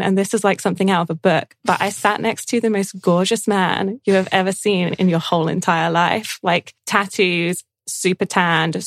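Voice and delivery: ordinary talk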